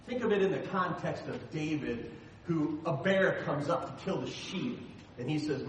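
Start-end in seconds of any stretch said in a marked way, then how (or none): none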